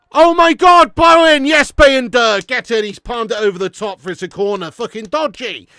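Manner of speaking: screaming at a big chance; loud, high live reaction; excited shouting. Real, not acted. Watching match, big chance.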